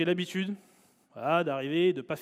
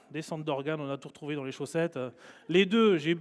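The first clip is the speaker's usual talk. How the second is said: ordinary talk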